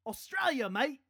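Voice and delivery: a bad, over-high Australian accent; hammed-up Aussie voice, badly faked; mock Australian accent. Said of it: "I'm not really good at accents".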